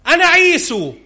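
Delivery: high, bright insistence; eager false claim. Acted. Son to blind father, insisting high and eager. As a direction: pitch it up, over-eager.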